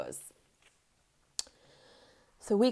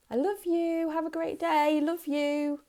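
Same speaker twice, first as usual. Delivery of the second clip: high, breezy, tossed-off "I love you"; flippant sing-song; 10 semitones higher than usual. Shown as a demonstration. A parent tossing the words off on the way out, breezy and high. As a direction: rattle "I love you" off carelessly, pitched way up. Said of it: "how many of us say that".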